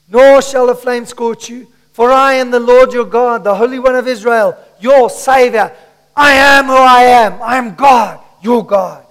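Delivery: loud, building proclamation of scripture; preacher at full force; impassioned preaching. Acted. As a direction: declare it as the voice of God. Proclaims God's words to congregation.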